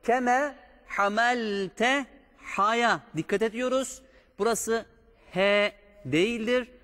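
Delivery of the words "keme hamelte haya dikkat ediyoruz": ordinary talk, nothing marked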